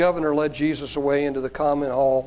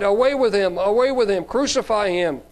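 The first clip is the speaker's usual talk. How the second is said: louder, higher crowd cry; 6 semitones higher, 4 dB louder than usual. A narrator's reading. Crowd rejecting their king before Pilate, crying out. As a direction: raise the voice like an insistent crowd. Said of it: "But they cried".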